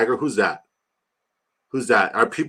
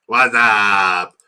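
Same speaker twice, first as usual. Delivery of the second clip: imitating a clip; 7 dB louder than usual. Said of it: "I gotta play it".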